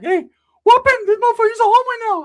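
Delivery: high, loud excited squeal; excited Ricky. Retold or imitated.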